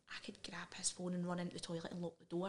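hushed, breathy secret whisper; a sneaky thought whispered under the breath. Retold or imitated. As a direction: whisper it like a guilty plan you'd never say aloud. Secret thought of reading his phone.

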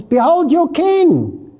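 high, slow proclamation landing on "king"; emphasis on king. Acted. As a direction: draw out "king" with a strong falling tone. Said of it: "Can you see the emphasis on the king?".

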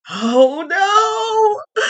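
high, hammed-up cry of fake terror; mock fright. Mocking a guy bragging he's from Chicago, fake frightened cry. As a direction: squeal it like you're pretending to be scared. Sarcastic fear at tough talk.

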